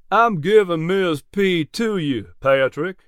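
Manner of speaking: lightly voiced genial old farmer; a hint of a friendly older neighbour. Narrator voices Jack Poindexter.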